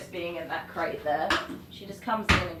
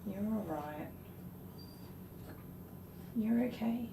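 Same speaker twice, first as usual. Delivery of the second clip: soft, higher reassuring voice; soothing; 4 semitones higher, 8 dB quieter than usual. Real, not acted. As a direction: comfort the dog gently. Comforting the dog while grooming.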